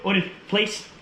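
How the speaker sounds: high, firm command to the dog. Real, not acted. Trainer sending Odin to his place, high command.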